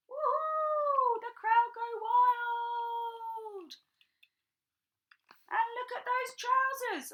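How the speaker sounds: high, loud whoop, slow on crowd go wild; cheering crowd. Pretend. Cheering crowd at Ziggy concert.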